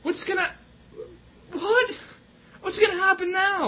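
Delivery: high, stunned disbelief; what, he died?; shocked. Real, not acted. Reacting to announcer's death.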